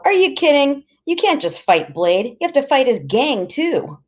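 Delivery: high, disbelieving protest; incredulous, pitch leaping up; incredulous retort. A narrator's reading. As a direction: push it up in disbelief. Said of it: "I go".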